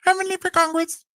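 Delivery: very high campaign squeak; high-pitched Heavenly mimicry. Co-host mocking Heavenly running for office, high-pitched voice. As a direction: proclaim it in a squeaky caricature. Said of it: "speaking in such a high-pitched voice".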